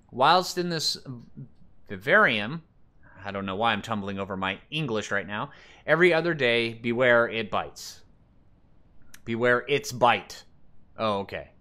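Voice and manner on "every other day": normal talking voice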